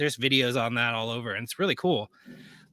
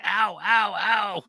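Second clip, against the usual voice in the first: lightly voiced plant crying ow; a hint of a hurt little plant; plant saying ow; 7 semitones higher, much slower than usual. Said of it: "it sounds like it's going like".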